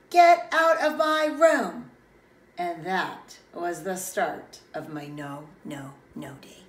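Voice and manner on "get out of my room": loud, high, drawn-out yell; angry shout. Acted. Child yells at Bob crawling round the room, shouting. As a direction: shout it out, stretching every word. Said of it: "So I shouted".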